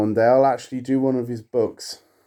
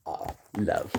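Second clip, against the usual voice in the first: dreamy romantic sigh; 7 semitones lower, 11 dB quieter than usual. Acted.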